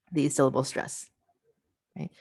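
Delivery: ordinary delivery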